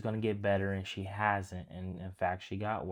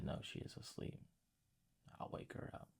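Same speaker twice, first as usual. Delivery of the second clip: soft whisper, barely voiced; Jesus whispers over the sleeping girl; 15 dB quieter than usual. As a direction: whisper it like a secret at the bedside. Jesus speaks over dead girl.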